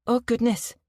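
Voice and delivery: a hint of Manchester in the sympathy; Mancunian accent. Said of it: "with a strong Mancunian accent".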